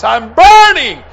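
high, loud yell; imitating a dying man's shout; top of his voice. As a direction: yell it in retold agony. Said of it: "The top of his voice".

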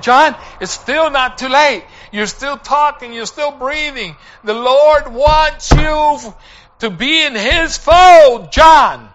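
high, urgent plea building to a shout; impassioned retold pleading. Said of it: "I pled with him".

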